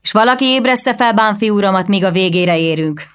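irritated. A narrator's reading. Prince irritably calls back to the seated guests. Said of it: "szólt vissza ... ingerülten".

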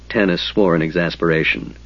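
usual speaking voice

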